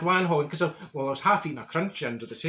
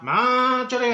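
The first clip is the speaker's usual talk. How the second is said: ghostly call; 8 semitones higher, 5 dB louder, slower than usual. Retold or imitated. Voice calling at seance.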